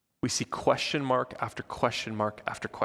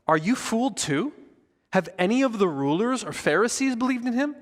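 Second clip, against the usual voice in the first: higher-pitched incredulous questions, read in character; incredulous challenge; 8 semitones higher than usual. A narrator's reading. Pharisees responding to their servants, incredulous. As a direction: challenge them with pitch lifted on each question.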